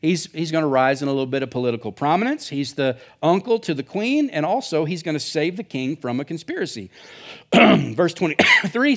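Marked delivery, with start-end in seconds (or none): none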